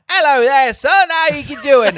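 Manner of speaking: loud, high-pitched hammed-up Cockney; Cockney accent. Junkyard man hailing the kids, broad loud Cockney. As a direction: belt out a cartoon Cockney greeting. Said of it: "me doing a British accent".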